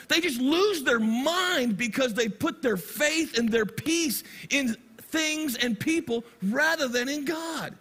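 ordinary talk

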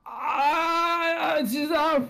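high strained mock wail; mock anguish. Real, not acted. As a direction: whine like it's killing you. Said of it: "is just killing me".

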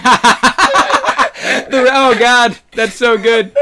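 helpless laughter breaking into the words; laughing. Real, not acted. Cracking up at a co-host's morning pun, laughing.

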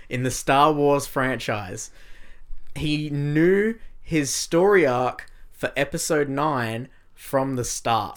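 very slow, spaced-out headline read; exaggeratedly slow explanation for the listener; slowed-down repeat. Shown as a demonstration. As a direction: draw out each phrase deliberately. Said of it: "I'll slow it down for you".

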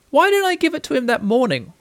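high, small-voiced question; child, puzzled. A narrator's reading. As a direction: ask it high, like a little boy.

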